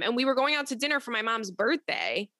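usual speaking voice

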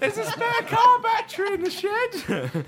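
shouted through laughter; laughing. Real, not acted. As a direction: squeal it out laughing. Laughing joke about a car battery.

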